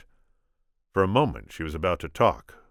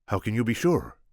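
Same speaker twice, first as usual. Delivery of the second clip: put-on Spanish accent; 6 semitones higher, 4 dB louder than usual. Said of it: "my attempt at Inigo".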